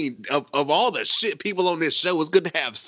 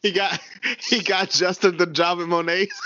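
laughing; 4 semitones higher than usual. Real, not acted. Laughing mid-sentence.

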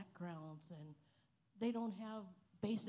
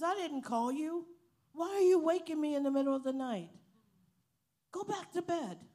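a touch of an irritated uncle, higher; annoyed uncle; 7 semitones higher, 9 dB louder than usual. Retold or imitated. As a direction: play the grumpy woken uncle lightly. Uncle woken in the night.

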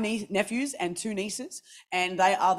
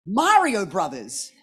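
mock exasperated shout; slower than usual. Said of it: "I'm like".

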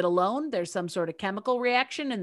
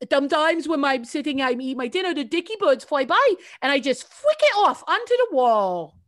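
bird lady voice; 5 semitones higher, 6 dB louder than usual. Retold or imitated. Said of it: "She goes".

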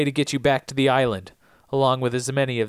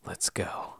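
whisper; 13 dB quieter than usual. Retold or imitated. Locke voiced in recap.

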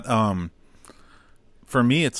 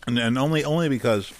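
mouth full; 3 semitones higher than usual. Real, not acted. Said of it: "I'm sorry, I'm eating".

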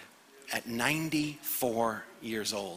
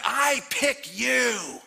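slow, loud shouted declaration; booming slow "I pick you"; God choosing; 13 semitones higher, 7 dB louder, much slower than usual. Acted. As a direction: proclaim it slowly like God picking you. God's voice in sermon.

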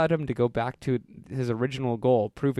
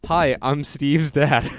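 slow, louder mock-serious dad voice; slow fake dad; 2 semitones higher, 6 dB louder, much slower than usual. Boy posing as a dad on the phone, slow and mock-serious. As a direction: draw out a fake grown-up voice. Prank call, posing as a father.

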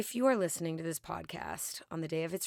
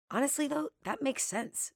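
much higher, startled-sounding turn; sudden high pitch; 9 semitones higher than usual. Real, not acted. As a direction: jump up high in surprise.

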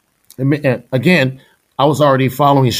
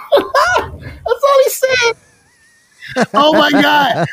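laughing; much slower than usual. Real, not acted. Laughing at the memory.

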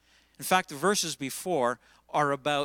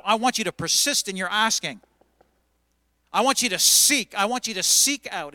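louder, pressing hard on WANT; loud urging; 3 semitones higher, 7 dB louder than usual. Real, not acted. Preacher urging his congregation to seek, loud and forceful. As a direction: drive the call home with force.

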